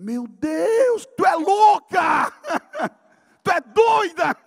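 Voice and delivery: high, loud mock outrage with a laugh; retold yell. Preacher reenacts yelling at his therapist in loud outrage. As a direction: yell at her like she's crazy, half laughing. Patient yells at rough therapist.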